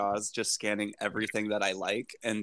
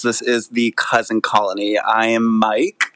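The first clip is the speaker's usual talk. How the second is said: podcast intro; 8 dB louder, much slower than usual. Acted. Podcast opening greeting.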